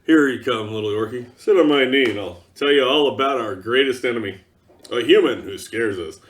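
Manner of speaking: deep, cosy grandfather storyteller, lightly mocked; fatherly orc. Orc elder telling a youngling a bedtime story, mock tender. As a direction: coax the little one onto your knee. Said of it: "this sort of fatherly talk".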